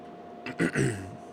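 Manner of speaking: short throat clear. Real, not acted. Clears throat while reading sizes.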